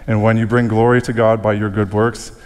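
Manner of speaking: ordinary talk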